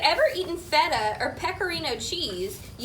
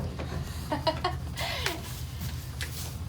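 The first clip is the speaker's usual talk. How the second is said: soft laugh; 4 semitones higher, 7 dB quieter than usual. Real, not acted. Laughs after whispered aside.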